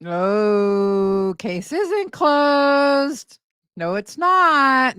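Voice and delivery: drawn-out, drawling rebuttal of a claim; mocking contradiction. Real, not acted. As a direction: stretch each word out slowly, scoffing. Mockingly contradicts the played clip.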